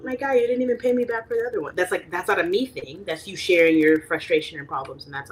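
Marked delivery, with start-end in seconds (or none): 0.0-1.7 teasing retort. Retold or imitated. Retorting to a borrower.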